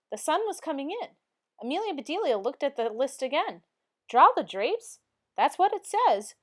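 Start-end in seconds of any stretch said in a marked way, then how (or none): 4.1-6.4 puzzled surprise. A narrator's reading. Reading the odd instruction.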